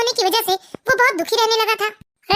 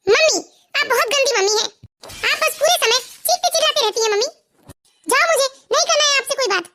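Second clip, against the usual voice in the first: a small daughter's hurt, pouting voice, a bit higher; sulking little girl; 3 semitones higher than usual. Acted. Little daughter tells her mother she is a bad mummy, sulking. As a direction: pout and turn away like a cross child.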